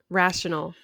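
ordinary talk, nothing marked

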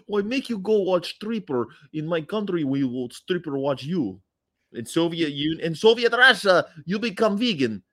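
high, hammed-up Russian accent; Russian comic accent, pitched high; Yakov Smirnoff impression. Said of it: "Yakov Smirnoff never gets old".

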